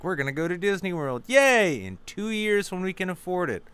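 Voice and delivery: high cheery parental promise; excited parents. Retold or imitated. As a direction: sell the trip like an overexcited parent.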